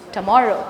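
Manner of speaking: "tomorrow" with a quick weak "tuh"; schwa first syllable. Shown as a demonstration. Teacher modelling the correct "tomorrow", weak schwa first. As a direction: reduce the start of "tomorrow" to a schwa. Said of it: "has the schwa".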